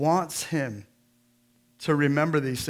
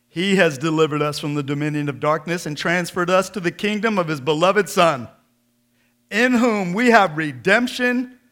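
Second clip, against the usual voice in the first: louder, rising preacher's proclamation; full-force preaching; 3 semitones higher, 7 dB louder than usual. Acted. Preacher reading Colossians to the congregation, proclaiming loudly. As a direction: proclaim it to the whole room.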